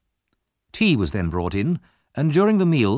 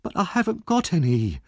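put-on high-pitched, anxious boy protesting; greatly alarmed; 8 semitones higher than usual. A narrator's reading. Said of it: "greatly alarmed".

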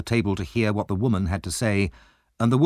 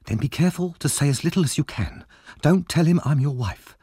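lightly voiced wife, pitched higher; a sharp wife's hushed warning, higher voice; Mrs Bumble; 7 semitones higher than usual. A narrator's reading. Wife warns her husband before the meeting, higher-pitched voice.

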